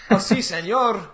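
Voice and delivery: put-on high, playful Mexican accent; tossing off Spanish with a Mexican accent. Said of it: "How did I do, Steve?".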